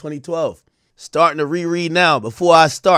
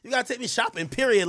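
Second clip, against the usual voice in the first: mock sassy girl voice, higher; playing the demanding girlfriend, higher pitched; 8 semitones higher, 6 dB quieter than usual. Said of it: "[name]'s like".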